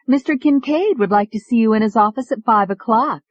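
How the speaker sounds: high, eager secretary; lightly voiced young woman. A narrator's reading. Secretary delivers the news.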